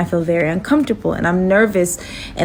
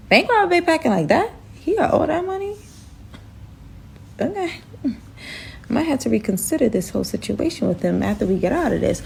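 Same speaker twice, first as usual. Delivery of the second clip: higher, softer scheming aside; sly aside; 4 semitones higher, 5 dB quieter, faster than usual. Retold or imitated. Herself reconsidering the robber's money, sly and soft. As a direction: muse to yourself, impressed.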